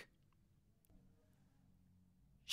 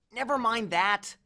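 higher, drawn-out urging; urgent interruption; 7 semitones higher, slower than usual. A narrator's reading. Grover tugging at Percy's shirt, urging. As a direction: cut in and hurry them along. Grover urges them to move on.

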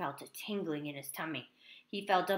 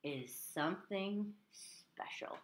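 a slow, softer proud "My son is something special."; 4 semitones lower, 5 dB quieter, slower than usual. Acted. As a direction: say it like a father proud of his son. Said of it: "replied Crispin's father proudly".

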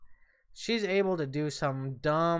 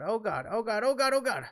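ordinary talk

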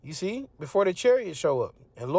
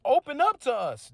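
high, louder begging at the door; desperate plea of those shut outside; pleading cry; 10 semitones higher, 3 dB louder, slower than usual. Acted. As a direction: knock and beg to be let in.

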